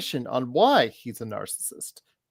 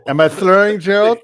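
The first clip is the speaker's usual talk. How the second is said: slurred, high mocking question; mock drunk slur; 7 semitones higher, 10 dB louder than usual. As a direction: imitate a drunk guy asking. Mocking his own drunk question.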